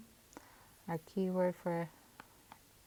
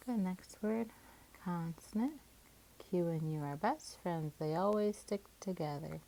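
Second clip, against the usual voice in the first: normal talking voice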